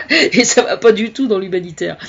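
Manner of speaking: words broken up by real laughter; amused, still giggling while talking; laughing. Real, not acted. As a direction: laugh through the line. Cracks up at her own remark.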